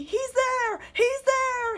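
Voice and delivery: high loud panicked cry; frightened cry. A narrator's reading. Boy runs to mommy in fright, high loud cry. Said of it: "he cried".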